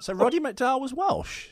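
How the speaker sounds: high, loud put-on Welsh lilt; Welsh accent. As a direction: ask in an exaggerated Welsh singsong. Imitating Welsh while asking about it.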